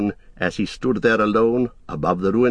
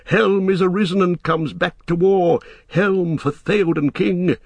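triumphant charge cry; riders' war cry; 8 semitones higher than usual. A narrator's reading. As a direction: call the host to war. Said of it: "the riders shouted".

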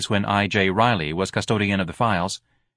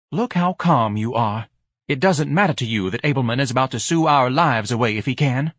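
higher, nagging society wife; fussy wife voice; 4 semitones higher, 3 dB louder than usual. Acted.

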